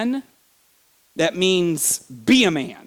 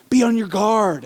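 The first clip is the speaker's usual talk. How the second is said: urgent exhortation; 6 semitones higher, 5 dB louder than usual. Acted. Voicing Paul's urgent commands.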